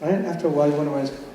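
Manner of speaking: ordinary talk, nothing marked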